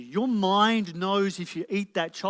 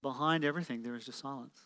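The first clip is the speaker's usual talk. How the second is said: hushed repeat; 2 semitones lower, 8 dB quieter, faster than usual. Real, not acted. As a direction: let it sink almost into stillness. Repeats the line softly after silence.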